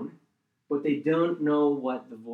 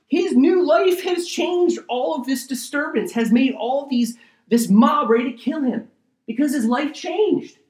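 loud, high, driving preacher voice; preaching at full force; 8 semitones higher, 8 dB louder than usual. Acted. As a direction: thunder it from the pulpit.

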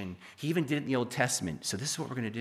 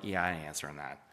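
low, offhand shrug of a line; dismissive brush-off; 9 semitones lower, 6 dB quieter than usual. Retold or imitated. Man glancing at his ringing phone, offhand and dismissive. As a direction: wave off the caller, unbothered. Screening a call from God.

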